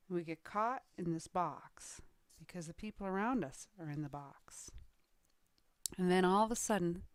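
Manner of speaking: ordinary delivery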